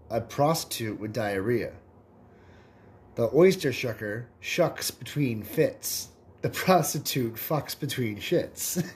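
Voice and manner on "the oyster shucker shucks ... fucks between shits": slow punchline. Acted. Punchline delivered slowly.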